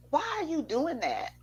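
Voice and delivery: normal talking voice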